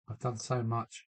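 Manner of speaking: ordinary talk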